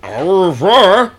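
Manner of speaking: hammed-up French goodbye; mock French. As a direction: toss it off like a fake Frenchman. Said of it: "is that how we're talking now".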